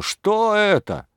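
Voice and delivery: "Что это?" stretched slowly at a very high pitch; drawn-out outraged question. Acted. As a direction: draw "Что это?" out, high and slow, in disbelief. Mayor berates the constables.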